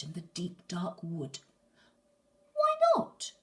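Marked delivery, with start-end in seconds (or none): 2.5-3.3 high, loud pleading cry; high shout. Acted. Gruffalo's child begs her dad, high loud cry.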